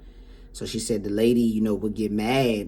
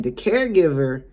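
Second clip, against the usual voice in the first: higher, drawn-out plaintive voice; putting on a wronged client; imitated client complaint; 5 semitones higher, 4 dB louder, slower than usual. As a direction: stretch out a mock-innocent grievance. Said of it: "this is how she's saying".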